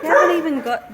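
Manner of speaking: ordinary talk, nothing marked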